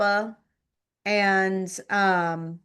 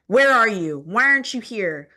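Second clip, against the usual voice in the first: loud imitation of a scolding mom; mock angry demand; 3 semitones higher, 7 dB louder than usual. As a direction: demand it crossly, as an imitated parent. Mother's angry texts voiced.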